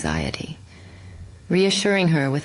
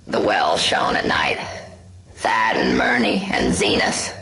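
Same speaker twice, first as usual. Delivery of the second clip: dying farmer's crackle. Acted. Dying Nahum croaks his last words to Ami, crackling and failing. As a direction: gasp out the last words of a man being eaten away. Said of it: "crackle out a final answer".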